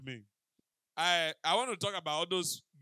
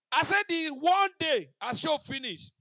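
loud slow telling; 8 semitones higher, 10 dB louder, slower than usual. Retold or imitated. Storytelling punchline, shouted slowly.

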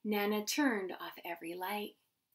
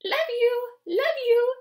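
very high, loud bird twitter; twittering auntie; 13 semitones higher, 10 dB louder than usual. A narrator's reading. Auntie bird twittering to Chicky, high twitter. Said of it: "Auntie twittered".

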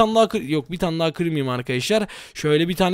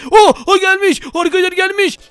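surprised; 11 semitones higher, 6 dB louder than usual. Real, not acted. Enemy suddenly appears.

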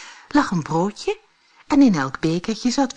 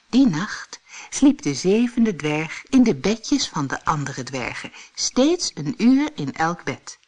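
ordinary delivery, nothing marked

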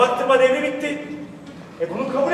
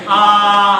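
long loud open aah; 11 dB louder than usual. Shown as a demonstration. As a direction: call out a big sustained aah. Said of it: "Aa diye sesleneceksiniz".

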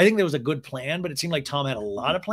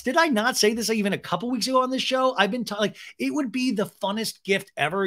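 high-pitched, worked-up pleading; retold rant of excited disbelief; incredulous excitement; 6 semitones higher than usual. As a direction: gush insistently, pitch riding high. Recounts pleading excitedly for vinyl.

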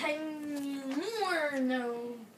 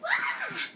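sharp, high, breathy shriek; a sudden startled scream, no voice in it; 3 dB louder than usual. Acted. Toy character screams at the pink person, high shriek.

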